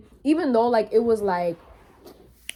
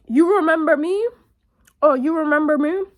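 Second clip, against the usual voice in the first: higher, louder mock old man's question; pestering old man; 6 semitones higher, 4 dB louder than usual. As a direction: imitate a stranger insistently pestering, pitched up.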